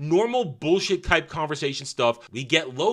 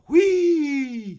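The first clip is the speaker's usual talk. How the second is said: mock squeal; 6 dB louder than usual.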